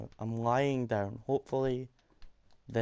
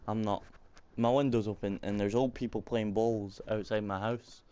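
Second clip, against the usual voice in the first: normal talking voice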